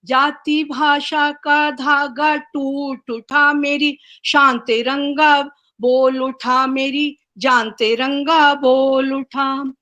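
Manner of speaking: measured, sing-song recital with each line repeated; poem recitation. Acted. Poet reciting her tricolour poem to the online gathering, rhythmic recital. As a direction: lift the refrain like a patriotic anthem.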